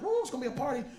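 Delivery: mock-excited, high-pitched whoop; high, giddy anticipation; excited party whoop. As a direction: cheer it like a party is starting. Excited party expectation, acted.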